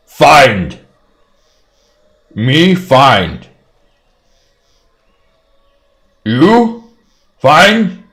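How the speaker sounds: loud, high broken giant speech; halting giant voice, high and loud; simple-minded giant. Acted.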